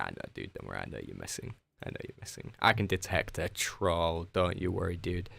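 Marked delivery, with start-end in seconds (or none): 0.0-1.5 much lower, softer teasing growl; mock deep voice. Teasing a chat troll. 1.9-2.5 whispered, soft teasing aside; playful whisper. Pretend. Teasing a chat troll.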